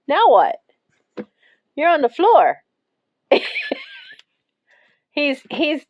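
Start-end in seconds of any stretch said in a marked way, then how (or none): 3.3-5.9 high, squeaky attempt to talk through laughing; helpless laughter. Real, not acted. Owner watching her cat in a shirt, laughing too hard to finish. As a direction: start the sentence and collapse into real laughter.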